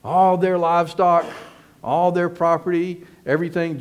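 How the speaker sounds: a hammed-up bargaining pitch to the townsmen; selling pitch. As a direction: sell it like a smooth dealer. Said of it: "here's the big selling point".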